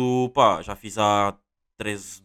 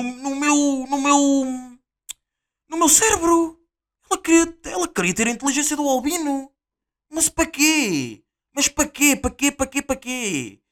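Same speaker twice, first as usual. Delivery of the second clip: indignado agudo; 5 dB louder, slower than usual. Real, not acted. Outraged rant about the nurse.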